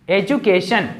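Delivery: ordinary delivery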